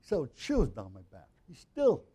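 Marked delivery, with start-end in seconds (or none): 1.5-2.0 "still" pushed high and loud in "He's still"; mock emphatic still. Pastor imitating friends' surprised "still", mock-emphatic. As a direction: lean hard on "still", a touch incredulous. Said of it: "And the way they say".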